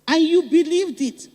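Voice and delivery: an accusing cry to the crowd; reproachful outburst. Real, not acted. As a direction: scold the listeners with force. Rhetorical rebuke to the crowd.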